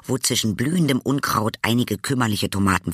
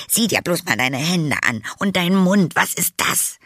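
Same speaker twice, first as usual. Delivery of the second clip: higher-pitched, indignant scolding; 7 semitones higher than usual. Acted. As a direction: tell off a child caught red-handed. Aunt scolds the boy she caught. (empört schimpfend)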